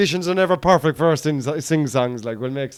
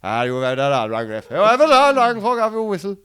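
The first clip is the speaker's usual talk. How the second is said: higher, louder ranting at the ref; mock sideline parent; 7 semitones higher, 4 dB louder than usual. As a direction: berate the referee like an angry parent. Parent abusing referee.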